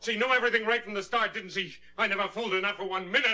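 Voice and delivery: fast, high ranting; agitated. Acted. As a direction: spit out the suspicion. Man rants about dead wife.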